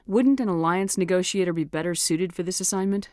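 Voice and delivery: usual speaking voice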